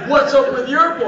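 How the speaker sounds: high, pointed comeback; retort thrown back. Retold or imitated. Iranian guests throw visitor's question back at him, indignant retort. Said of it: "What's up with your boy shit with us".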